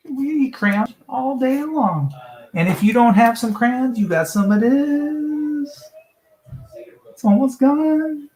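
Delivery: put-on high slow childish voice; kindergartner voice. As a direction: play a kid eating crayons. Kid voice about crayons.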